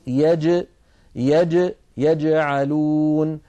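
يجعلون with the jim echoing and released, slowed; bouncing qalqala jim. Shown as a demonstration. Teacher demonstrates qalqala to students, slow and exaggerated. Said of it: "تخرج بالتباعد بين هذين الطرفين".

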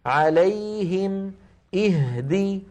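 "عليهم" then "اهدي" in matched slow timing; measured, equal holds in "عليهم اهدي"; equal letter length. Shown as a demonstration. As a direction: hold the ya of "عليهم" as long as the h of "اهدي". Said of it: "نفس الطول".

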